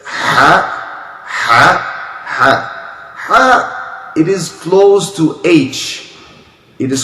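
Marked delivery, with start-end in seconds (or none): 0.0-4.1 a breathy throaty "ha"; Arabic letter ha. Shown as a demonstration. Teacher demonstrating the throat letter ha. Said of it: "Next letter is".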